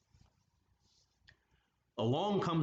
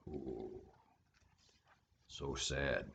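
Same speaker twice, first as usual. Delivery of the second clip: sad sympathy; 10 semitones lower, 9 dB quieter, slower than usual. Real, not acted. Reader pities the fish.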